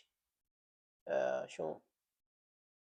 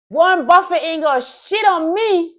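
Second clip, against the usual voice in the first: shouted boast, much higher and louder; 14 semitones higher, 23 dB louder than usual. Acted. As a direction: brag it out at full volume.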